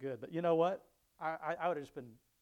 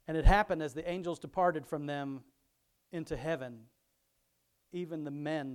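louder, measured Bible reading voice; scripture reading; 2 semitones higher, 10 dB louder, slower than usual. Acted. Pastor reading Luke aloud to his congregation, louder reading voice. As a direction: read the passage out to the congregation.

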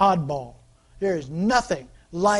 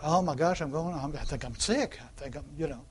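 softer, fretful, lightly hammed-up; mock worried sick person; 3 semitones lower, 5 dB quieter than usual. Preacher imitating a congregant panicking over a sore throat, softly fretful. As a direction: fret over a scratchy throat, half-mocking. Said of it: "Anybody get a little scratch".

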